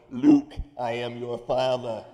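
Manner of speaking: slow, loud, masked movie-villain voice; playful Vader voice through a sleep mask; Darth Vader impression. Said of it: "if I'm in a playful mood".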